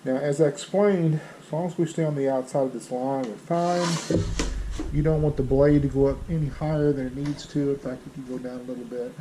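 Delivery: muffled through a respirator mask; speaking behind a dust respirator; n95 respirator. Real, not acted. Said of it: "a respirator on".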